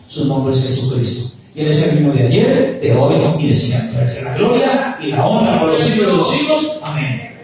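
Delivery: loud, proclaiming sermon voice; full-force preaching. Acted. Preacher exalting Jesus before the congregation, loud and fervent. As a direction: declare his glory with power.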